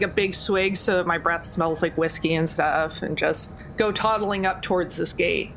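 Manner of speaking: normal talking voice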